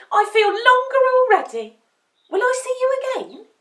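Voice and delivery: high, loud puppy voice; thrilled, eager young pup; delighted puppy. A narrator's reading. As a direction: burst out with happy excitement.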